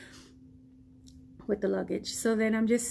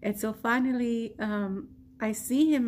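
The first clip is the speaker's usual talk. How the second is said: ordinary delivery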